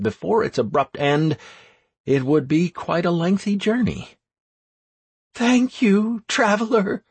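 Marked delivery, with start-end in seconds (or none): 5.3-7.0 high grateful woman; woman's voice. Acted.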